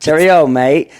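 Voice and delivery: loud, jaunty mock British-Aussie accent; British-Australian accent. Shown as a demonstration. Showing friends his accent slip, British-Australian. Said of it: "doing an Australian accent into a British accent".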